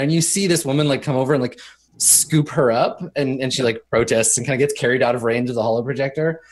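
ordinary talk

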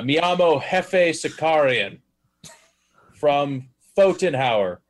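loud, slow, stern, badly mangled Spanish. Player fakes Spanish to the gunners, slow, loud and stern. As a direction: bark it slowly like a tourist who thinks volume translates. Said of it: "just says it louder and more stern".

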